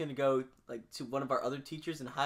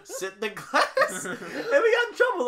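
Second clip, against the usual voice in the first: laughing; 11 semitones higher, 8 dB louder than usual. Real, not acted. Laughing while telling prank story.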